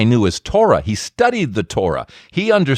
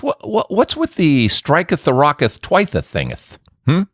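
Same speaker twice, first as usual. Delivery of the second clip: mock old English; 4 semitones higher than usual. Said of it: "And he says".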